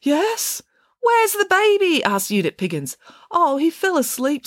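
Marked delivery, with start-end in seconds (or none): 0.0-0.6 high-pitched answering voice; timid reply. A narrator's reading. Joseph answers being called. 1.0-2.0 high, loud, drawn-out question; urgent demand. A narrator's reading. Pig demands where baby is. 3.3-4.5 high-pitched, innocent reply; innocent explanation. A narrator's reading. Joseph explains himself innocently.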